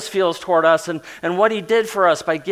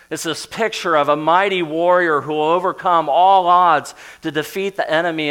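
ordinary talk

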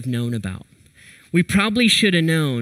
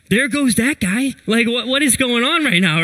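raised, incredulous; baffled disbelief; 8 semitones higher than usual. Retold or imitated. Watching a friend storm off, high baffled disbelief. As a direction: stare after him in disbelief.

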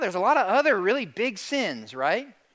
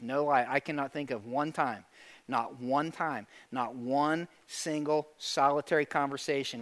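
low, soft, grave confession; quiet, earnest pastoral aside; hushed confiding; 7 semitones lower, 9 dB quieter than usual. Real, not acted. Pastor confides a grave personal observation.

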